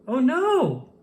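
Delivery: sudden high, loud alarmed cry; surprised oh no. A narrator's reading. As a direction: gasp it out as the pile topples. Pile of pillows collapses.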